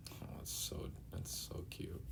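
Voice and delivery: real whisper, barely audible. Real, not acted. Viewer moved by Nami's village, tender whisper. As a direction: whisper it, touched by the moment. Viewer reacts to touching scene.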